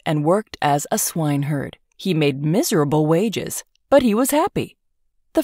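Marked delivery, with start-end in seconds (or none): none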